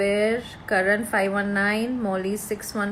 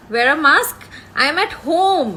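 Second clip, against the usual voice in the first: louder, higher, emphatic protest; indignant retort; 6 semitones higher, 6 dB louder, slower than usual. Real, not acted. Host answering a viewer's mask comment, indignant. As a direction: raise pitch and volume in protest.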